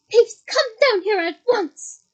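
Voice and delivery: loud, slow furious order; angry command. Acted. McGonagall ordering Peeves down, loud and angry. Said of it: "yelled an angry voice".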